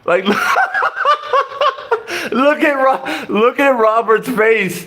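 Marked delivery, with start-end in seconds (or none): 0.3-2.2 high squealing laugh; can't hold back a real laugh. Real, not acted. Laughing at Robert's confused face, high squealing laugh.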